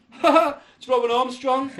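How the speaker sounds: high, loud, drawn-out gleeful greeting; mocking ambulance man. Ambulance man recognising the patient, gleeful and loud. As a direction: crow it slowly with a mock laugh.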